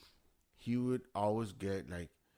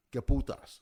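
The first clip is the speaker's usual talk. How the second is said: fed-up outburst; 6 semitones higher, 9 dB louder than usual. Retold or imitated. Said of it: "was like".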